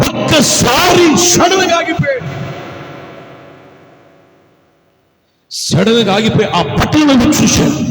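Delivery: loud Telugu pulpit delivery; fiery preaching. Acted. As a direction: preach it out to the crowd. Preaching at full force.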